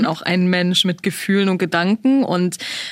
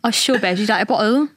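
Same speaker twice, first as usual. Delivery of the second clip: put-on playful British accent; 2 semitones higher than usual. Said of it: "diese Sprache ist halt voll schwer".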